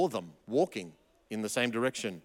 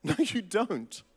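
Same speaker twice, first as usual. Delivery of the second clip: laughing repeat; 3 semitones higher, slower than usual. Real, not acted.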